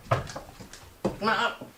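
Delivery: slow breathy whisper to a pet. Real, not acted. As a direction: coax gently in a whisper. Coaxing pet softly.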